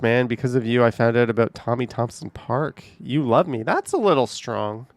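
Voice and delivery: ordinary talk, nothing marked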